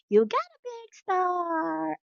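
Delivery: high, excited "big star"; proud teacher praising child; praise. Acted. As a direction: announce the reward with delight. Rewarding child.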